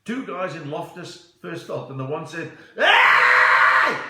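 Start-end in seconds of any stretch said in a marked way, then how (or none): 2.8-4.0 high, full-force stadium yell; huge shout. Acted. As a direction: holler across an empty stand. Joke character yells in stadium.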